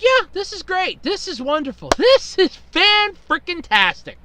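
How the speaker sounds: dry sarcasm, dragging out fan-frickin-tastic; mock cheer that means the opposite; sarcastic. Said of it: "So it's like".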